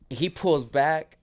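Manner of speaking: usual speaking voice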